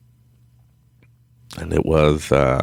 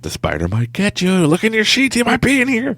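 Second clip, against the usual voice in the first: rising into high mock panic; panicky inner voice; 3 dB louder than usual. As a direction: play a frantic mind nagging you, climbing higher. Mind nagging about the spider.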